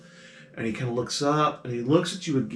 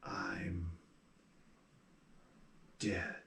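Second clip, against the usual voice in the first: softer and lower, hollow and slow; hushed ghost; 5 semitones lower, 20 dB quieter than usual. Acted. Ghost telling the player character quietly that he is dead. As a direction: drop it almost to nothing, stating it plainly.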